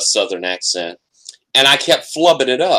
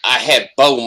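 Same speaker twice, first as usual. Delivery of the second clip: ordinary talk, nothing marked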